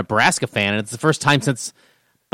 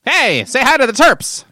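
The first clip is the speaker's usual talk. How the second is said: put-on old-timey voice; 9 semitones higher than usual. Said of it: "we're back in old-timey voice".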